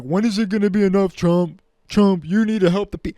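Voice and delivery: mock them begging like children; mock pleading politicians. Mocking politicians pleading with Trump, loud whiny voice. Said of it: "all these people being like".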